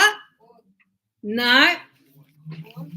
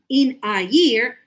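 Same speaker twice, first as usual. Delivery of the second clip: "In a year" slow and louder, words apart; 5 dB louder, slower than usual. Shown as a demonstration. Teacher showing unnatural careful speech, word by word. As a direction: separate each word of "In a year". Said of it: "we don't speak like that".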